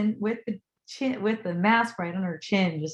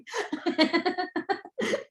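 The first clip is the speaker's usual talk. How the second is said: high, rippling laugh; 11 semitones higher than usual. Real, not acted. Storyteller amused by the hug, laughing. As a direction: burst into giggles at your own story.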